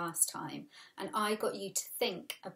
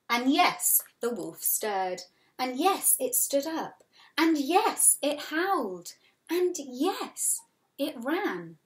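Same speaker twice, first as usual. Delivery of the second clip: rising higher and louder with each yes; building excitement; 6 semitones higher, 5 dB louder, slower than usual. A narrator's reading. Reading the wolf waking to the class with rising excitement. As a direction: build the thrill as the wolf wakes.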